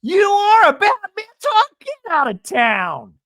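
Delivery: loud, high-pitched mock disbelief; over-the-top excitement. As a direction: squeal it as a hyped-up imitation. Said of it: "all of a sudden is on a twenty".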